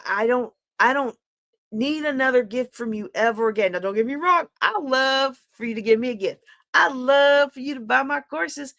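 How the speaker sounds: ordinary talk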